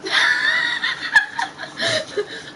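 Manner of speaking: squealing burst of laughter; high shrieking laugh. Real, not acted. Reading embarrassing fanfic to friends, shrieking laugh.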